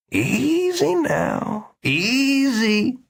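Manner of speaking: very slow, drawn-out soothing; easy, calming. A narrator's reading. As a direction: settle him like a spooked animal. Doctor calming Victor.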